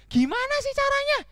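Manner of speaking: very high-pitched insistent question; squeaky, eager donkey; pleading donkey. Acted. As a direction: beg to know the secret.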